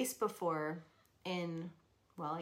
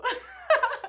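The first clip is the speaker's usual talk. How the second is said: squealing high laugh; 12 dB louder than usual. Real, not acted. Mocking her husband's flat line, high laugh. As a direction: burst into a high, loud laugh.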